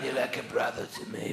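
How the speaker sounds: quiet, mumbled Godfather voice; Marlon Brando impression. Godfather at a christening, quiet mumbled Brando. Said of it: "doing Marlon Brando impressions".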